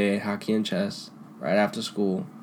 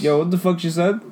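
high, shocked retelling; shocked disbelief; 7 semitones higher than usual. Retold or imitated. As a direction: recall being stunned. Shocked reaction, retold.

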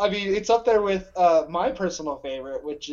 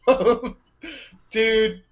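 normal talking voice

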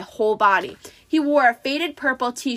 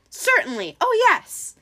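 eager high fake-bride voice; high-pitched pretend bride; falsetto; 11 semitones higher than usual. A narrator's reading. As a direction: agree brightly in falsetto. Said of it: "in his falsetto voice".

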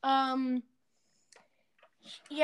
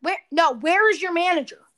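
shouting match; 10 dB louder than usual. Acted. Karen demands the manager.

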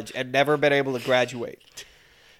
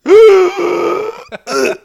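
a huge high choking gasp, loud; loud drunk gasp; 11 dB louder than usual. Retold or imitated. Roommate mimicking drunk roommate's apnea gasp, loud choking gasp. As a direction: imitate a drunk man's choking gasp in sleep. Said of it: "And he would go".

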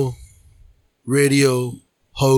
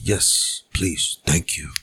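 soft low voice; 13 semitones lower, 8 dB quieter, much slower than usual. Pretend. Much lower and softer.

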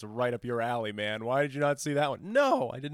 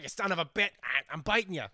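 higher, gruff spider in a Danny DeVito voice; 6 semitones higher than usual. Pretend. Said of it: "Voiced by Danny DeVito".

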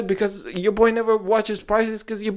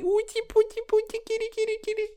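put-on very high, cooing baby talk to a kitten; high-pitched cutesy voice calling a kitty; cat baby talk; 6 dB quieter, faster than usual. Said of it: "And we all just like".

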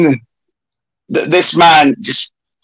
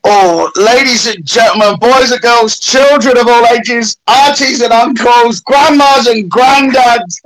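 ringmaster; 4 semitones higher than usual. Acted. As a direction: call it out like a circus ringmaster. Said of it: "I'm gonna let you introduce".

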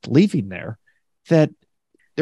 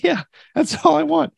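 much higher, pleading it's simple; exasperated insistence; 13 semitones higher than usual. Retold or imitated. Ordering at Subway, exasperated and pitched up high. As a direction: push it up high like it's obvious.

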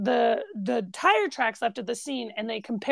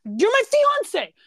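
high-pitched, aggrieved Brooklyn pleading; indignant Vinny; 8 semitones higher than usual. Retold or imitated. Vinny asking his fiancee for support, mock indignant. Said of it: "what does he say".

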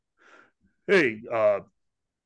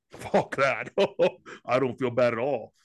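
ordinary talk

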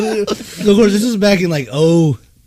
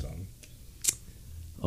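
whisper; 12 semitones lower, 20 dB quieter than usual. Real, not acted. Whispered aside.

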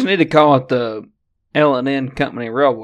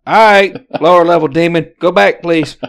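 mock exorcist; 5 semitones higher, 8 dB louder than usual. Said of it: "And you're just like".

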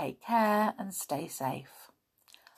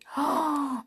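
mock gasp; 7 semitones higher than usual. Mock shock at chapter end.

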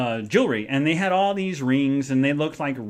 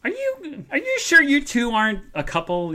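incredulous question; 11 semitones higher than usual. Retold or imitated. Receptionist asks skeptically.

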